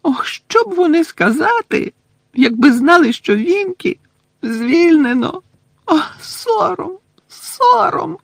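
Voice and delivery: high, loud tearful elf lament; wailing house-elf. Acted. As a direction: sob it out like a heartbroken elf. Winky wails, crying. (ридає ельфійка Вінкі)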